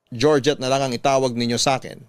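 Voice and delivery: ordinary talk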